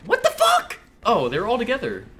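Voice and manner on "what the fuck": real shock, much higher and louder; startled yell. Real, not acted. Gamer caught off guard by the enemy team, startled yell. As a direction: yelp it as if ambushed. Sudden surprise in game.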